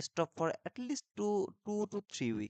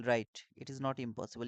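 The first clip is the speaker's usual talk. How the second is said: ordinary talk, nothing marked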